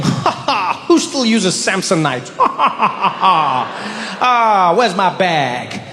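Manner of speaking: mocking laugh, slow and drawn out; smug laughing. Comedian at the carousel mocking others' luggage, smug laughter. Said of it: "judging them".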